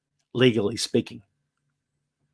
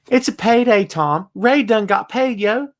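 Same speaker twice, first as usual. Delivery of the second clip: excited; 7 semitones higher than usual. Real, not acted. Hyped host cheering a wrestler's payday, excited. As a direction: pitch jumps up, hyped and playful.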